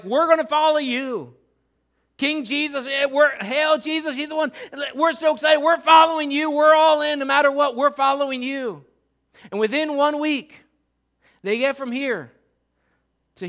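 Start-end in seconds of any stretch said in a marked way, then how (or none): 0.0-8.8 loud, high hailing of a king, with a laugh; excited crowd. Retold or imitated. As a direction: cheer like devoted fans swearing loyalty.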